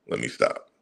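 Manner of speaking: breathy whisper, reining himself in. Real, not acted. Ranting vlogger hushing himself to stop, whispered.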